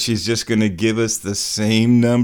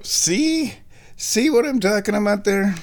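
much higher, mocking squeak; squeaky voice; 11 semitones higher than usual. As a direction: tease in a squeaky voice. Mocking high voice.

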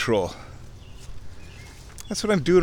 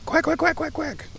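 rapid, very high quacking; squeaky-high burst of duck quacks; mimicked duck quacks. Shown as a demonstration. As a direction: do a frantic little duck. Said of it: "and then you do it".

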